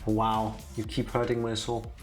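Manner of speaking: normal talking voice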